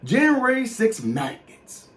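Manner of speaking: ordinary delivery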